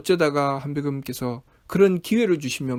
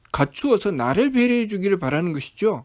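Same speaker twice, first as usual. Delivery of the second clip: ordinary talk, nothing marked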